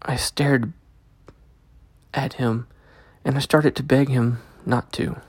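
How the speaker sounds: ordinary delivery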